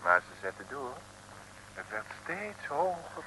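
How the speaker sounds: usual speaking voice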